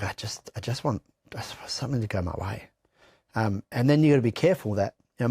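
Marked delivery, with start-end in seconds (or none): 0.0-2.6 breaking whisper. Real, not acted. Pleading for something to go right.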